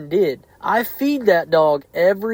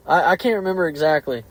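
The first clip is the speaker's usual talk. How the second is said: ordinary delivery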